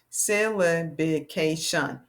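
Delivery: "Syllabication" slow, in five distinct beats; syllable by syllable. Shown as a demonstration. Teacher confirms five claps, "Syllabication" slowly in separate syllables. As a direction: count out "Syllabication" one syllable at a time. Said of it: "Five syllables in that big word".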